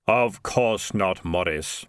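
slow, weighty voice; otherworldly authority. Acted. Unearthly figure scolding Morris about cat gods, slow and solemn. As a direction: rebuke him slowly, with solemn authority.

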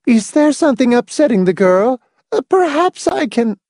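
high-pitched, fussing matron; anxious. A narrator's reading. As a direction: offer help in worried concern. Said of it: "inquired anxiously".

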